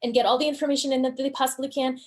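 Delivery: racing through it fast, no pauses; hurried student cramming information; rushed speech. Shown as a demonstration. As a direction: cram every detail in at top speed. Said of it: "talking like this".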